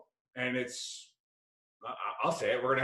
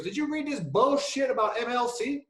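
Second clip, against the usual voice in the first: put-on much higher, indignant take on a buddy's accent; King's Buddy Beckwith accent; 10 semitones higher, 3 dB louder than usual. Buddy griping to him about MLC, indignant mimicked accent. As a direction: mimic his accent, voice climbing in disbelief. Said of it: "his Buddy Beckwith accent".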